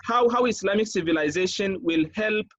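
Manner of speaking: usual speaking voice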